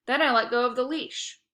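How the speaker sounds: ordinary delivery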